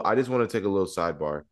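ordinary talk, nothing marked

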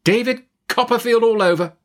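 high, scornful cry; exclaiming Miss Betsy. A narrator's reading. Aunt exclaims over her nephew's folly, scornful cry. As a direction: cry it out in exasperation, pitched high. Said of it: "cried Miss Betsy".

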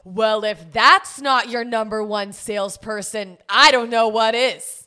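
loud, slow mocking proclamation; obnoxious male friend. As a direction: imitate a loud jokester, drawn out and building. Mimics male friend's loud joke.

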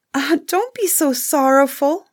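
high, earnest plea to cheer him; pleading comfort. Acted. Beauty begging the sad prince not to grieve, pitched high. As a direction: coax him out of his sorrow. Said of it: "cried Beauty".

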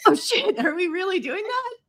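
high, laughing disbelief; shocked. Retold or imitated. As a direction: gasp it out, half laughing. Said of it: "And I was like".

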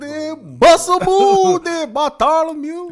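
slow, high sing-song call; high drawn-out call. Acted. Teasing call at the goat.

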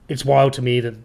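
usual speaking voice

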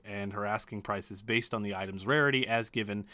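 ordinary talk

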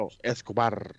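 Escobar with a rolled r. Shown as a demonstration. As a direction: roll the r in Escobar, Spanish-style. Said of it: "[name], do Escobar again, 'cause I can't roll my".

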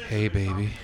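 mock seductive. Shown as a demonstration. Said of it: "Give us the".

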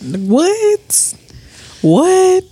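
baffled, squeaked-up what; incredulous what. Real, not acted. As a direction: throw it up in mock shock. Mocking disbelief at yellow bone.

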